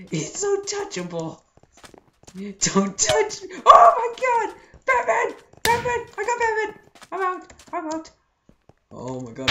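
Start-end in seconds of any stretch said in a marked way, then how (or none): 3.6-8.1 excited yell. Real, not acted. Excited after tagging Batman.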